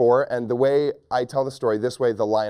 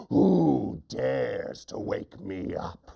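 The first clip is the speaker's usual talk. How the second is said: slow, drawn-out lion voice; ferocious lion; 2 semitones lower, much slower than usual. Acted. Lion to the mouse that woke him, slow ferocious demand. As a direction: growl it slowly as a woken lion. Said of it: "lion woke up ferocious".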